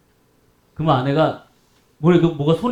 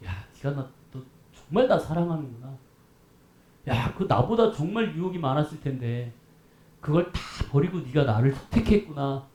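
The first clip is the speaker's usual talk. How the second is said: low, soft, fond voice of God; lightly voiced God, moved and proud; tender voice of God; 6 semitones lower, 9 dB quieter than usual. God praising a believer who refused temptation, low and soft. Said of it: "하나님이 뭐라 그러시겠어요?".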